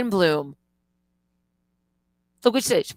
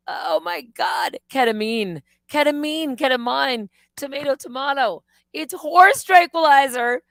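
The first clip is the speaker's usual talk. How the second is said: sarcastic disbelief; 6 semitones higher, 5 dB louder than usual. Retold or imitated. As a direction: scoff at it in disbelief. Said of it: "I was like".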